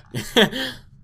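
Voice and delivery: squeaky stifled giggle; high giggle. Real, not acted. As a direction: giggle helplessly at it. Co-host shushing, giggling.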